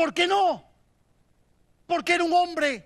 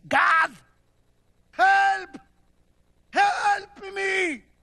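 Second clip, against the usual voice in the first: loud desperate cry to God; 7 semitones higher, 6 dB louder, much slower than usual. Retold or imitated. Sinner crying out to God in despair, loud plea. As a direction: shout for help, pleading.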